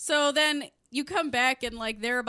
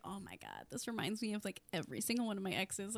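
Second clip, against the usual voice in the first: much softer, low muttered aside; hushed aside; 4 semitones lower, 13 dB quieter, faster than usual. Retold or imitated. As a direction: drop it to an undertone. Quiet aside.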